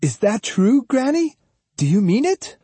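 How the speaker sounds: put-on high-pitched child's voice, asking earnestly; questioning boy. A narrator's reading. Captive Jotaro asks Granny if it's true, boyish.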